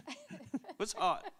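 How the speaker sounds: high, slow and laughing; laughing echo. Real, not acted. Laughing along at the podcast table. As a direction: echo it through a giggle. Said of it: "legit hot".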